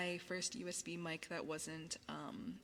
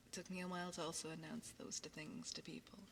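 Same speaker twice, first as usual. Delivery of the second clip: whispering; 8 dB quieter than usual. Acted. ASMR whisper.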